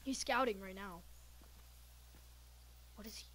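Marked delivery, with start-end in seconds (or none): 2.9-3.2 whispering. Real, not acted. Hiding, whispering in fear.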